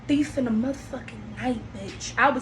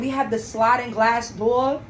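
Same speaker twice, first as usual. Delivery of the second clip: ordinary talk, nothing marked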